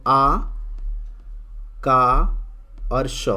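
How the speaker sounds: आ, का and श sounded out very slowly with pauses; teacher sounding out Akash; syllable by syllable. Shown as a demonstration. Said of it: "जैसे यहाँ पे शब्द है आकाश".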